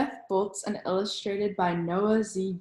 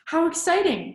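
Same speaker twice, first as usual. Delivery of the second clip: excited grandma; 8 semitones higher, 4 dB louder than usual. Acted. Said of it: "Grandma says".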